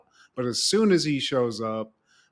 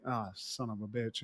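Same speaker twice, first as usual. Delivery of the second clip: much softer, under-the-breath swear; muttered curse; 6 semitones lower, 14 dB quieter than usual. Retold or imitated. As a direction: mutter it to yourself, lightly imitated. Cop finds bullets, curses.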